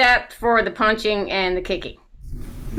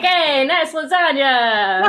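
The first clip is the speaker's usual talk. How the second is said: mock Italian gesture talk; 5 semitones higher, much slower than usual. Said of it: "I'm like".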